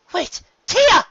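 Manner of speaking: high, loud cry after a departing sister; desperate shout. Acted. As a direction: scream her name as she vanishes. Luna cries out to sister.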